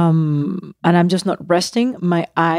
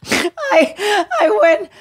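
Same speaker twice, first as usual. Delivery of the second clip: high breaking laugh through the words; laughing hard; 13 semitones higher, much slower than usual. Real, not acted. Father laughing while telling the bathroom ending, high and breaking. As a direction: crack up while trying to go on.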